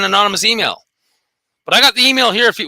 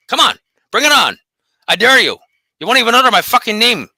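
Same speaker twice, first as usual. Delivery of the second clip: ordinary talk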